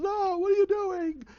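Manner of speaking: high, stretched-out wail; panicked protest. Retold or imitated. Man being dragged off by guards, panicked.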